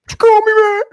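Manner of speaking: very high, loud put-on squawk; mock outrage. [name] pretends to be insulted to start trouble, high mock outrage. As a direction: shriek it in staged disbelief. Acting out staged outrage at slur.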